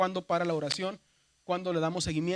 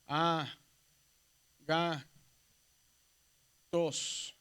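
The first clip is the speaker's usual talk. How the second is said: "a, ga, tos" con pausas largas entre sílabas; sílaba por sílaba; 2 semitones lower, much slower than usual. Shown as a demonstration. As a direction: separa cada sílaba: a... ga... tos. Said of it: "cuando dividimos en sílabas". ("a, ga, tos" silabeado despacio)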